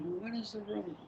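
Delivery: imitated patient-but-firm parent; retold parent reminder. Parent's reply to child, retold.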